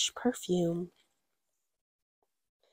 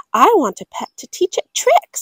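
put-on high, louder girl voice; child's voice; 7 semitones higher, 5 dB louder than usual. Girl telling parents what she wants, high pleading. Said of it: "said Gwendolyn".

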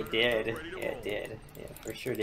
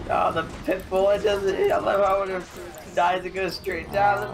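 high, loud, amused storytelling; laughing about a unit dropping straight down; excited giggly telling; 8 semitones higher, 5 dB louder than usual. Real, not acted. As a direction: tell it with delight, voice up high. Joking about a game unit dying.

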